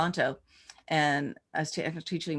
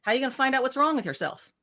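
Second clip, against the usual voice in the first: higher, quick breezy reply; matter-of-fact Nancy; 6 semitones higher, faster than usual. Retold or imitated. Scene: school owner casually answering the teacher, breezy and higher. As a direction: toss it off as obvious.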